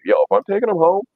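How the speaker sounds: high, insistent; high demanding. Retold or imitated. Making his friends ride along, high and insistent. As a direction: squeak it out as a demand. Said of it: "and I'm like".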